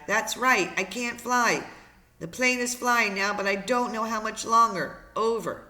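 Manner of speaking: loud, high, anxious reply; urgent distress call. Acted. Brian confirms to operator he can't fly, urgent distress call.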